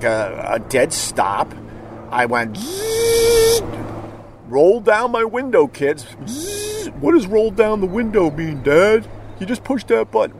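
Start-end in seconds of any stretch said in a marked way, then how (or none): 2.6-6.1 high, hammed-up dad with crank noise; mock dad. Dad miming window crank. 6.3-10.4 high, bratty mock kid. Kid voice mocking dad.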